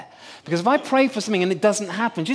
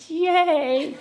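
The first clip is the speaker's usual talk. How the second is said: put-on child's cheer; 8 semitones higher than usual. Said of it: "And she said".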